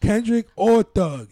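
high, loud and slow; loud emphatic. Real, not acted. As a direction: shout the names slowly. Arguing about rappers.

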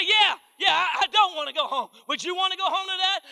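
high, loud shouted defense; husband yelling back. Retold or imitated. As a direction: bark back at the wife, way up and loud. Husband fires back at wife.

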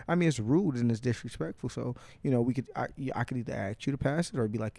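ordinary talk, nothing marked

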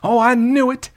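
very high, loud mock boast; hammed-up triumphant audience cry; imitating noisy moviegoer. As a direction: crow it like an obnoxious know-it-all. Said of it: "would have a verbal reaction".

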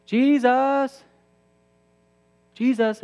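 high, loud cries of Jesus; crying out to Jesus at night, afraid; calling out. Retold or imitated. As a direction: call out his name, pleading. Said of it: "I call out".

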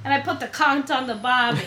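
badly faked Aussie; Australian accent. Shown as a demonstration. Co-host has a go at Aussie talk, horrible Australian accent. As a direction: attempt a joking Australian accent. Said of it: "that was a horrible accent I just did".

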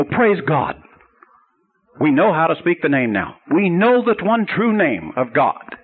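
acted-out joy at a long-sought answer; triumphant rejoicing. Students celebrating the rabbi's answer, triumphant rejoicing.

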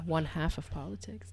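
whispered aside; whispering a joke. Real, not acted. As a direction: drop to a breathy whisper.

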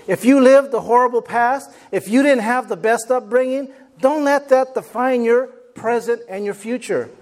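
loud, rising fired-up preaching; preaching at full force. Acted. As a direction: build it up like a closing exhortation.

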